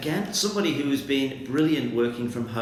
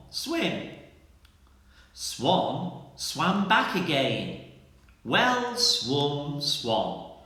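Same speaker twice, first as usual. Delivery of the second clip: slow, careful tongue twister, word by word; very slowly; 3 dB quieter, much slower than usual. Shown as a demonstration. Teacher reads a pupil's tongue twister to the class, very slowly. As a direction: lay each word down slowly and clearly. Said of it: "I'm gonna say it very slowly".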